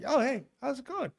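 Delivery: husband answering the sister-in-law cheerfully; cheery greeting. Retold or imitated. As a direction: imitate his own innocent phone hello. Retold phone call, cheery greeting.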